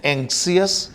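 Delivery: एंक्सियस with the i sounded, 'ank-si-us'; common mispronunciation. Shown as a demonstration. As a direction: say एंक्सियस in three beats, ending 'see-us'. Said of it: "कुछ लोग इसको बोलते हैं". (एंक्सियस, 'सियस' वाला गलत उच्चारण)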